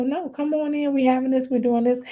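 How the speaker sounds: high, lightly voiced mom; lightly imitating a hospitable mother; imitated welcoming mom. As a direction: coax a guest inside in a high, easy voice.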